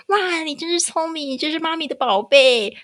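high, doting mommy praise. Retold or imitated. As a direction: coo praise at the child. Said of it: "小时候跟他讲说".

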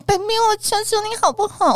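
put-on baby voice. Shown as a demonstration. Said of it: "我怎么就有一种老痰的感觉啊".

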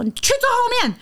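high-pitched mock yell at his girlfriend; retold yell. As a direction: snap the order out high, half-acted. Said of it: "他就突然吼我说". (模仿男友高声吼叫)

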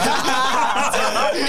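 big high laugh, rising and loud; loud burst of laughter. Real, not acted. Host cracks up at the ear-wiggling contest, loud laugh. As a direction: crack up loudly.

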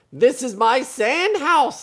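high, loud and drawn out; defiant story boy. Acted. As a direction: declare it proudly as the boy. Boy in story defends his house.

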